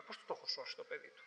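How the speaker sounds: breathless whispered question; stunned whisper. Retold or imitated. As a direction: whisper it in disbelief. Stunned, asks how he saved it. (ψιθυριστή απορία από έκπληξη)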